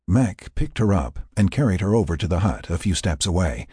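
ordinary delivery, nothing marked

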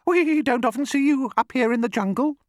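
very high, chirpy bird voice; singsong bird. A narrator's reading. Roly-poly bird chats to the crocodile, high singsong. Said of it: "sang the roly-poly bird".

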